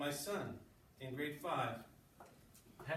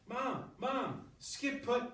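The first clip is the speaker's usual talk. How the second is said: put-on high, urgent child's cry of Mom, mildly loud; mock child shout, pitched up; screaming for mom; 8 semitones higher, 3 dB louder, much slower than usual. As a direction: read as a kid bursting in calling for mom. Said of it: "burst into the room screaming".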